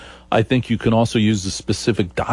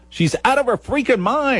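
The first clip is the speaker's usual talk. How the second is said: high mocking squeak, far higher; a high mocking girlish voice; 14 semitones higher than usual. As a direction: squeal it like a mocked pop star.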